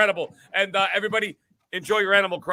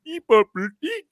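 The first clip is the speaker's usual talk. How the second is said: squeaky nonsense syllables, jumping much higher; mock game babble; 6 semitones higher than usual. Mocking Animal Crossing villagers' babble, high and squeaky. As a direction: mimic a cheap voice actor's chirps. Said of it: "some asshole sitting in a booth going".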